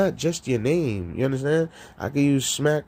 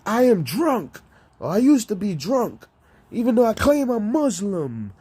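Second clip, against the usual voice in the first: mock rapper reply; 9 semitones higher than usual. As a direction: answer as the rapper, high. Voicing [name]'s reply.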